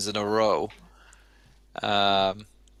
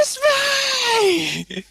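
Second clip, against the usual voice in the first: piercing long shout; 5 dB louder, much faster than usual. Retold or imitated. Podcaster imitates Vicky Guerrero's entrance catchphrase, piercing long shout. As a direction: shriek it like Vicky Guerrero, holding the last word. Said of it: "She did shout it too long".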